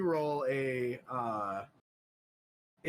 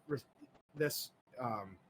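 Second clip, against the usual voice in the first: normal talking voice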